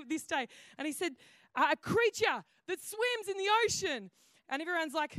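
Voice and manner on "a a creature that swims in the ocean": higher, louder eager clue-giving. Retold or imitated. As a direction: push the clue out urgently. Husband giving game clue.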